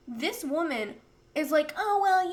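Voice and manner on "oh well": high, mocking doctor voice. Mocking the neurologist's breezy advice, high. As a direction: mimic the doctor's breezy tone, higher.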